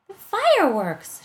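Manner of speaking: high, loud and drawn-out cry; excited shout. A narrator's reading. Jessie hearing the fireworks start, shouting excitedly. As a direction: yell it out with thrilled alarm. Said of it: "shouted Jessie".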